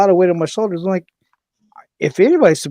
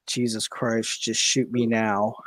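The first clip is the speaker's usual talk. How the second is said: pained mutter; 4 semitones lower, 7 dB quieter, slower than usual. Retold or imitated. Migraine pain, quiet groan.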